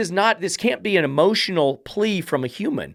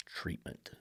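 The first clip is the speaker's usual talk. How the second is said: mocking whisper of Treatment; 11 semitones lower, 22 dB quieter than usual. Real, not acted. Trainer whispering the text's word Treatment in scorn. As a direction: breathe Treatment out as a sneer.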